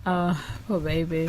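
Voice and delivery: hushed, low pitying aside; soft pity. Real, not acted. Mock pity for the man.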